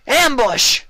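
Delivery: very high, loud cry of alarm; shouted warning. A narrator's reading. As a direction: bark the alarm.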